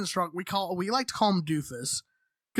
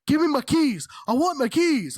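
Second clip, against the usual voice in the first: loud, higher mock drunk demand; angry drunk demand; 6 semitones higher, 5 dB louder than usual. Drunk roommate demanding his keys, loud and angry. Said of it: "And he's like".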